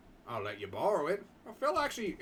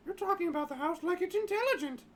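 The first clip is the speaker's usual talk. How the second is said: much higher, sceptical reporter voice; Simone voice. Acted. Reporter Simone dismissing talk of the house being alive, high sceptical voice. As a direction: scoff high like a doubting reporter.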